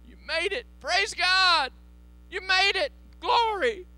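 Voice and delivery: very high, loud delighted welcome; greeting a friend in heaven, high and loud; joyful greeting. Retold or imitated. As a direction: cry out in heavenly joy.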